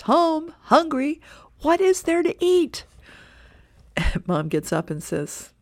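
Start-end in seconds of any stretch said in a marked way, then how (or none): 0.0-2.8 put-on much higher, loud teen call; son bursting in. Son shouting to his parents as he walks in, high and loud. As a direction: yell it as you burst through the door. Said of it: "who screams".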